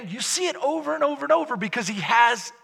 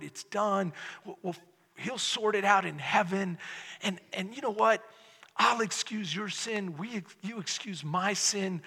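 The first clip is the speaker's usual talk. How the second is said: much softer, coaxing pretend pastor who excuses sin; mock soft-soap pastor; 4 semitones lower, 9 dB quieter than usual. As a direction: hush it down like a pastor telling people what they want to hear. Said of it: "How about I just say".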